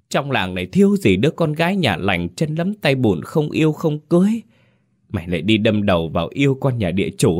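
a high, wailing cry to her late husband; keen it out like a distraught mother; wailing lament. Acted. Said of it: "gào rú lên như người mất hồn". (mẹ gào khóc than trời với chồng đã mất, giọng cao)

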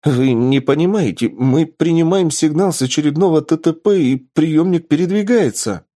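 high, urgent, excited report; взволнованная новость. Acted. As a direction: blurt out the discovery to the professor. Лаборант взахлёб сообщает новость профессору. (взволнованно, на повышенных тонах)

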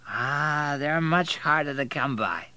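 put-on drunken commiseration; drunken man. A narrator's reading. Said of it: "He was so drunk".

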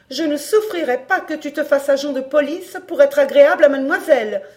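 mother forbidding her son, loud and angry. A narrator's reading. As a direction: forbid it outright, voice up. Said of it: "madame Gémozac perdit toute mesure".

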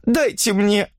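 high-pitched, shaky plea; anxious woman pleading; trembling request. A narrator's reading. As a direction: ask for it with a trembling voice. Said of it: "попросила она дрожащим голосом".

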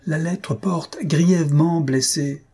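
slow, high-pitched emphatic retort; yelling. A narrator's reading. Father corrects his wife about the letter, mildly yelling. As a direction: hammer out each word in exasperation. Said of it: "hurla Jujube".

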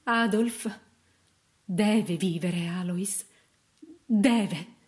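slow, anguished plea; grieving, drawn-out insistence; desperate prayer. A narrator's reading. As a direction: implore God word by word. Mother prays her baby lives.

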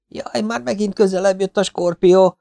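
high-pitched, panicky yelp; alarmed. Acted. Partisan warns the scorpion is creeping closer again, alarmed.

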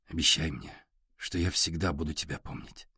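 hushed, low, near-whisper. A narrator's reading. Ярослав asks Сашка for a promise, half-whispered. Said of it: "сказал он шёпотом".

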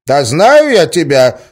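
put-on loud, high, drawn-out old man; old villager. A narrator's reading. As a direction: answer as a brusque village grandpa. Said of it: "отозвался старик".

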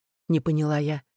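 usual speaking voice

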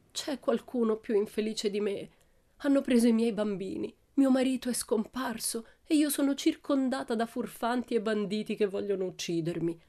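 high, wailing lament of a tragic countess; plaintive countess. A narrator's reading. Countess on stage bemoaning her fate, plaintive high lament. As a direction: moan it like a stage heroine. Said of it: "disse in tono lamentoso".

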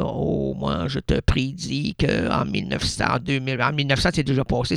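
hushed mock prophecy; mock psychic. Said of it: "des médiums extra larges qui te disent".

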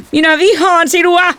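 very high, drawn-out scream; furious high-pitched shriek. A narrator's reading. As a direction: scream it in a child's rage. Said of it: "hän kiljui".

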